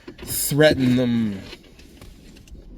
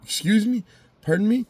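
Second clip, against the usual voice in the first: mock disbelief; 10 semitones higher than usual. Imitating court's startled reply to refusal.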